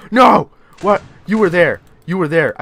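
loud, high, alarmed yell; panicked outburst. Real, not acted. Player spotting the creature in the game, panicked yell. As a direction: cry out in startled disbelief.

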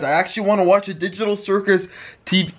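ordinary talk, nothing marked

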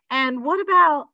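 words catching as she begins; struggling to ask. A narrator's reading. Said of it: "my mom struggled to get the words out".

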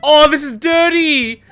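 loud, high cry of delight; excited outburst. Real, not acted. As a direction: crow it out, pitched well up. Gleeful reaction to game.

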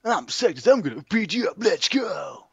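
high, swooping comic threat; hammed-up fighter's challenge. Improvising a fight with a rival, hammed-up challenge. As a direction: call out a mock challenge, pitched high.

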